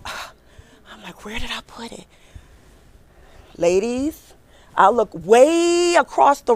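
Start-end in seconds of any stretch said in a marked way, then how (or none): 0.0-2.1 hushed panic. Retold or imitated. Panicking quietly during live interview.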